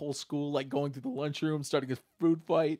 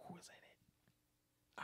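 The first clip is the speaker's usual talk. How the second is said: hushed question, breathy and soft; a soft whispered aside to the cohost; 24 dB quieter, much slower than usual. Real, not acted. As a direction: whisper it so nobody hears. A hushed aside mid-review.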